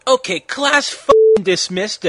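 lightly acted teacher's call; calling out to class.

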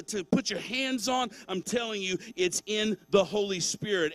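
loud, rising sermon climax, driving each phrase home; preaching at full force. Acted. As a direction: preach it hard, pushing the congregation toward the Spirit.

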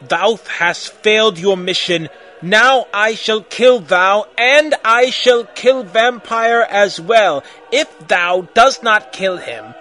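loud, drawn-out villain proclamation; hammed-up evil overlord; evil raspy villain. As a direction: pronounce doom slowly and loudly. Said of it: "said evilly in his raspy voice".

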